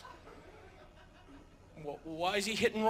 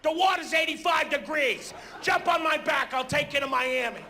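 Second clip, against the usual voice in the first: panicky idiot shouting; 6 semitones higher than usual. Retold or imitated. Panicking passenger on the sinking ship, shouting high. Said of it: "One guy's running around".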